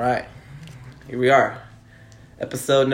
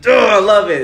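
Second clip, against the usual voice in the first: excited; 6 semitones higher, 7 dB louder than usual. Real, not acted. Cheering the strong stance.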